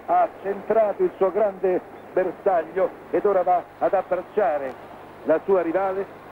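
ordinary talk, nothing marked

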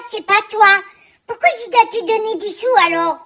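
loud, exasperated rant at an absent partner; scolding the business partner who never helps, loud and indignant. Acted. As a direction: tell him off as if he's standing there. Imagined letter scolding the partner.

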